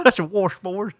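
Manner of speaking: Southern "warshboard" through laughter; mock Southern, amused; exaggerated Southern accent. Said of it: "that's the way we sound".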